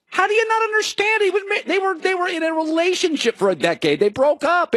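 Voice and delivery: how can you not get it, high and exasperated; high disbelieving outburst; incredulous. Real, not acted. Incredulous rant at fans.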